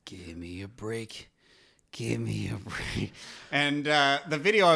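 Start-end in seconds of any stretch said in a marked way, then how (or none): 0.1-3.1 gruff grumbly voice. Retold or imitated. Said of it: "like, a gruff, grumbly way".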